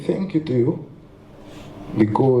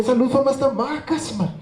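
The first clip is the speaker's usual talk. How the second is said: a higher, cheery Cape Flats greeting; imitating a Cape Flats hello, pitched up; light Cape Flats accent, higher; 13 semitones higher than usual. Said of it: "[name] still have the accent".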